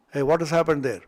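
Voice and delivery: ordinary talk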